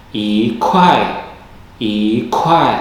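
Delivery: i in i kuai rising, kuai falling; model i kuai. Shown as a demonstration. Teacher models yi kuai for the class, clearly. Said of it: "i berubah menjadi nada dua".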